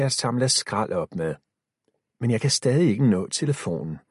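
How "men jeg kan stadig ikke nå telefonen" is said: ordinary delivery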